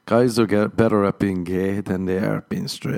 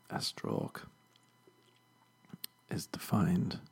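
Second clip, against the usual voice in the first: whispered, much softer reading; 2 semitones lower, 14 dB quieter, slower than usual. Real, not acted. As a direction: drop to a breathy whisper for the definition. Reading definition, drops to whisper.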